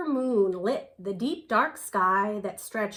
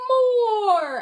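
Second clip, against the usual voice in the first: high and much louder, eager cry; cried out; 11 semitones higher, 9 dB louder than usual. Acted. The owls cry out for another story, high and loud. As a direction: shout for more story. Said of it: "everyone cried".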